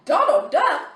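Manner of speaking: high rising, puzzled question; mock bewildered Donald Duck?; puzzled daughter. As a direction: echo it like a confused kid. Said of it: "my daughter's like".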